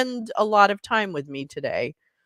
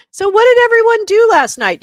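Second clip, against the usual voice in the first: much higher and louder, bubbly; excited call-out; 12 dB louder than usual. Real, not acted. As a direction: fire the question out to the room. Calling out to chat viewers.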